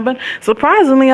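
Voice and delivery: ordinary talk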